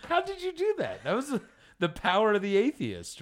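high amazed question; shocked, high pitched. Real, not acted. Amazed after the bit.

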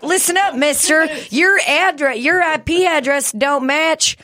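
high, bossy telling-off; stern scolding voice. Retold or imitated. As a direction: scold him like a strict teacher. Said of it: "and was like".

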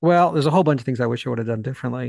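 normal talking voice

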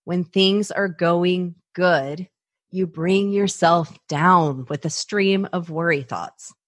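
slow, measured restatement; deliberate repeat. Shown as a demonstration. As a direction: spell it out slowly so it sinks in. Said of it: "Let me repeat that".

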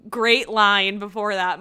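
normal talking voice

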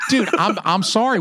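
ordinary talk

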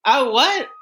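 high startled robot reply; squeaky confused assistant. Retold or imitated. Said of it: "And you'd be like".

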